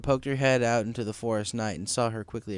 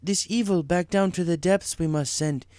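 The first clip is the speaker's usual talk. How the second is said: slow, higher ominous rhyme; Zecora voiced; 5 semitones higher, slower than usual. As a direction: pronounce it gravely.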